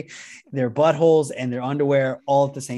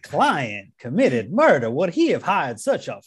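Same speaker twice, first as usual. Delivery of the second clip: slow Southern courtroom lawyer; lightly voiced Southern lawyer pleading; honeyed southern lawyer drawl; slower than usual. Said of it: "those old Southern men ... in the courtroom".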